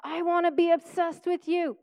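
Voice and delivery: a heartfelt prayer to the Lord; earnest prayer. Retold or imitated. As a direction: pray it out with longing. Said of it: "I actually pray".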